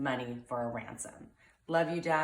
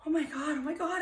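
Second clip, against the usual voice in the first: much higher, panicked oh my God; mock panic rising up high; retold panic; 12 semitones higher, faster than usual. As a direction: daughter retelling her frantic reaction. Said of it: "I'm like".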